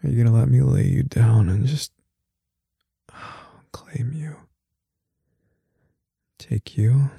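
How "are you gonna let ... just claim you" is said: usual speaking voice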